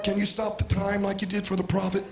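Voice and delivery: normal talking voice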